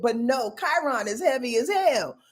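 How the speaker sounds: ordinary delivery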